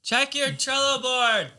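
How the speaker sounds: singsong teasing. Acted. Teasing reply in acted office skit.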